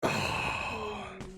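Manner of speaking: long breathy exhale after laughing; real winded sigh; recovering sigh. Real, not acted. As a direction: sigh out the last of a laughing fit.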